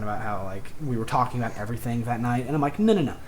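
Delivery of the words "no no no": higher-pitched retold protest; quick retold "no, no, no"; objecting. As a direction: cut in to correct her. Retold interruption of mother's claim.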